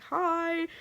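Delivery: high-pitched imitated "hi"; imitated friendly greeting. Singer waving to fan, high friendly greeting. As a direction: pitch it way up, lightly.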